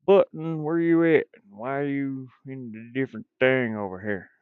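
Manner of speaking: cowboy voice. Pretend. Game maker hunting a lost button, cowboy voice. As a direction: call out like a hammed-up cowboy. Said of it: "Let me get my cowboy voice on".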